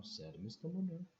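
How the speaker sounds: ordinary delivery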